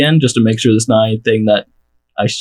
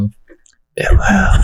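airy mock whisper of the chant; 7 semitones higher than usual. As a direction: breathe it out like a secret. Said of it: "all they hear is the whisper of".

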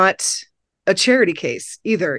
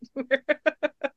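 high giggle; 8 semitones higher, 8 dB quieter than usual. Real, not acted. Laugh alone.

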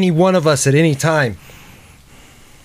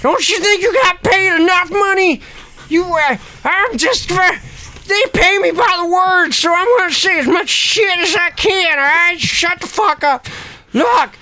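high-pitched cranky rant; irascible old Ernie. Acted. Ernie telling Jada to shut up, cranky and high. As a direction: rant back in an excitable old-timer voice.

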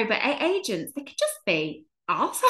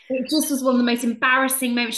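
usual speaking voice

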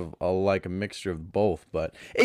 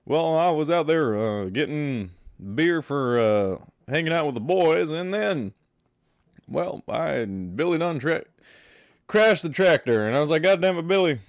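a light Texan dad twang, slightly higher; hint of a drawling Texas dad complaining; lightly voiced Hank Hill rant; 5 semitones higher than usual. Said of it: "Hank Hill".

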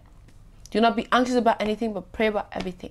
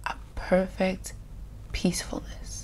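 very soft, fading into a whisper; hushed calm; 10 dB quieter than usual. Shown as a demonstration. Preacher showing the stillness after the storm, hushed. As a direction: let it sink to a peaceful hush.

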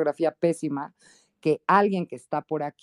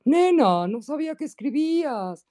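gallo Claudio voice; 7 semitones higher, 3 dB louder than usual. Retold or imitated. Friend praising her writing in a cartoon rooster voice. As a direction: play a blustery cartoon rooster calling her nena. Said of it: "Así como la del gallo Claudio de las caricaturas".

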